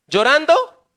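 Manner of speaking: a sudden loud, high cry of the word; cried out. Acted. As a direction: belt it out to the congregation. Preacher cries out the answer.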